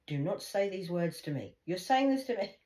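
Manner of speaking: mother warning daughter not to curse herself; retold warning. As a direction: scold her for cursing herself. Retelling heated hotel argument.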